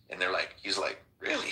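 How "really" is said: mock stunned high "really"; incredulous. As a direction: shoot way up in disbelief. Disbelief at the drone photo.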